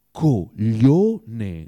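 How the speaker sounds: loud, drawn-out insult; emphatic, syllable-by-syllable insult; forceful. A narrator's reading. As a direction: hurl the word with force. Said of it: "disse Oblomov con forza".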